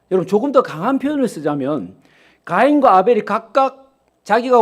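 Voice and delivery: preaching at full force. Acted. Preacher pressing the congregation about Cain, loud and high. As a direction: drive the point home to the pews.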